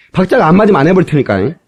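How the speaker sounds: ordinary talk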